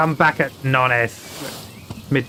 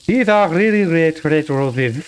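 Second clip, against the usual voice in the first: German accent practice run; imitated German accent. Shown as a demonstration. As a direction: parrot a strong German accent. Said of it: "That's our entry phrase".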